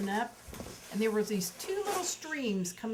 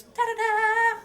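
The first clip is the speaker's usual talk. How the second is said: high, loud, sing-song ta-da; mock child showing off, high and loud; triumphant ta-da; 14 semitones higher, 12 dB louder, slower than usual. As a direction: belt it like a proud little kid finishing a trick. Toddler shows off after belly flop.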